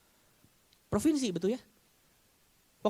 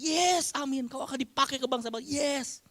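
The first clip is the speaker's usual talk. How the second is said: high, excited yes amen; lightly voiced enthusiastic congregant; mock eager amen; 8 semitones higher than usual. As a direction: ham up an eager believer. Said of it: "Kalau saudara berkata".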